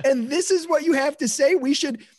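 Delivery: high, laughing outraged rant; mock fury with laughter breaking through; venting at the think tank, high and laughing; exasperated rant. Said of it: "You're like".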